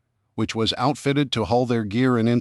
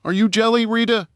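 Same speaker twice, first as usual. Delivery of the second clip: teasing; 13 semitones higher than usual. Acted. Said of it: "teased Dusty".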